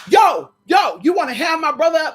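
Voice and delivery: shouted high call to brother; brother yelling. Retold or imitated. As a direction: yell it out, loud and high. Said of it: "he said".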